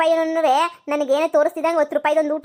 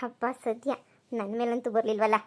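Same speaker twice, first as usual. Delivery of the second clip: much softer, lower sigh of relief; relieved aside; 3 semitones lower, 8 dB quieter than usual. Acted. Mother-in-law relieved the blame passed her, a much softer aside. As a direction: breathe out in private relief.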